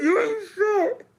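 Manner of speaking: very high, squeaky words through laughter; drawn-out squeal while cracking up; laughing insult. Real, not acted. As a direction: tease him, helpless with laughter.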